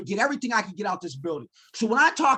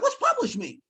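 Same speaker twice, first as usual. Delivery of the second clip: much higher, puzzled question; naive surprise; 14 semitones higher than usual. Retold or imitated. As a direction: ask innocently, not knowing the word. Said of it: "I said".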